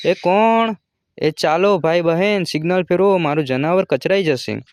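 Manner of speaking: high, loud calling out; frantic yell at the locked house; desperate shout. Acted. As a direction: shout through the door for help. Jumo kicks door, calls for help.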